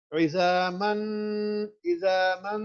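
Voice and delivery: عظاماً with lips closed on the meem, sound through the nose; nasal ghunnah. Shown as a demonstration. Teacher showing the nasal meem. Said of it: "ناک میں سے آواز نکالیں".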